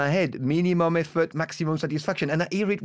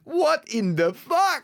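slow, high mock disbelief; shocked disbelief; 11 semitones higher, much slower than usual. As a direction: drag it out, stunned. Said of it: "he looked at you and he went".

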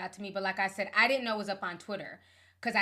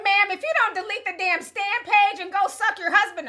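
loud retort; 9 semitones higher, 8 dB louder than usual. Retold or imitated. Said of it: "I said".

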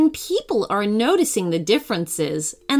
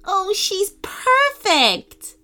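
delighted; 11 semitones higher, much slower than usual. Acted. Nina delighted by talking dog.

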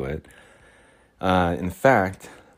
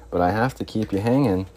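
usual speaking voice

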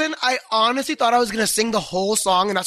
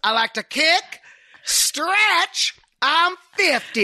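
high, stretched-out comic character voice; Molly Shannon character; 9 semitones higher, much slower than usual. Acted. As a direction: drag it out high and showy, mimicking a sketch character. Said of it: "Do you remember that character".

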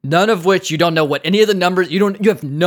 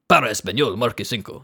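Para Español with rolled r, real Spanish vowels, lower; 7 semitones lower, 3 dB quieter than usual. Shown as a demonstration. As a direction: say marque cinco like a native Spanish speaker. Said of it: "That's what it should say".

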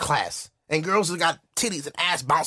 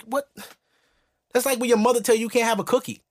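normal talking voice

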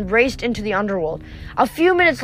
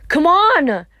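louder, higher hurrying call; urgent call; 6 semitones higher, 5 dB louder than usual. A narrator's reading. Grover tugging Percy's shirt, urging him on. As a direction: urge them along out loud.

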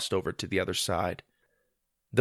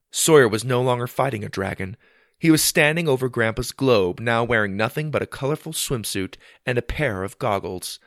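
usual speaking voice

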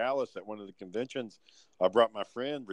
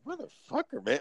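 exasperated curse. Retold or imitated. Batman fan rubbing his chest.

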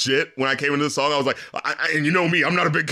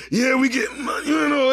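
high, off-key shout; off-key rapper impression; 7 semitones higher than usual. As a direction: yell it out of tune. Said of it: "sounds like it's out of tone".

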